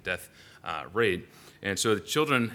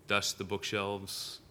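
ordinary delivery

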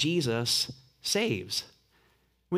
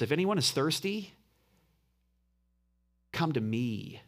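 ordinary talk, nothing marked

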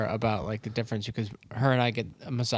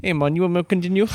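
put-on fast, higher Jamaican accent, rising at the end; 8 semitones higher, 7 dB louder, faster than usual. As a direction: redo the beach masseuse in a Jamaican accent. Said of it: "in a Jamaican accent".

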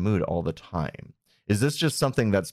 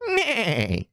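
high whinnying neigh; whinny like a horse; 12 semitones higher than usual. Shown as a demonstration. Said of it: "I can't do a horse whinny".

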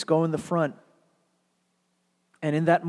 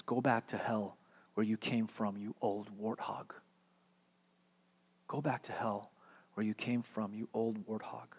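quiet curse; 4 semitones lower, 8 dB quieter than usual. Acted. Mary Grace cursing Mrs Turpin.

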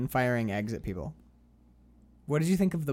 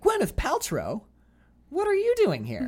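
high, loud shocked question; shocked surprise; 6 dB louder than usual. Retold or imitated. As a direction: act stunned to see her. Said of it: "he comes back and he's like".

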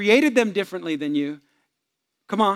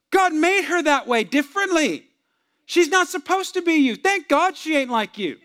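high, loud, exasperated telling-off; emphatic rebuke; 9 semitones higher, 4 dB louder than usual. Retold or imitated. Pastor correcting a husband in counseling, loud exasperated rebuke. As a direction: set him straight at full volume.

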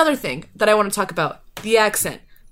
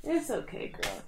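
soothing dog; 6 semitones higher, 15 dB quieter than usual. Real, not acted. Soothing the dog she woke.

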